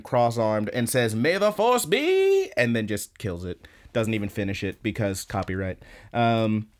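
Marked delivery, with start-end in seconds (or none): 1.1-2.5 high, drawn-out mock-heroic proclamation; acted swooping battle cry. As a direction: stretch it out high, like a hero swooping in. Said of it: "She flies at him ... and says".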